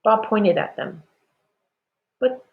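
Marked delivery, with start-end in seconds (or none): 2.2-2.4 puzzled father. A narrator's reading. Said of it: "he wondered".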